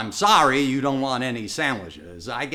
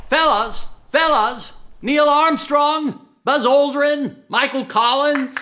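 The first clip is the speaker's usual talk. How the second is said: loud, higher hail across the crowd; calling out; 9 semitones higher, 4 dB louder, slower than usual. Acted. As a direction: call out to get the astronauts to turn around. Hailing astronauts as they arrive.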